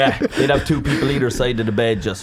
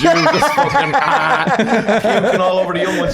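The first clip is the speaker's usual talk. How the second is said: mock retching; 9 semitones higher, 3 dB louder, slower than usual. Mimics puking, high.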